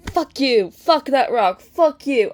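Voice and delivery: real fan fury, shouting at a fictional rock; furious shout. Real, not acted. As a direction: yell it out at the rock in outrage. Yelling at a book character.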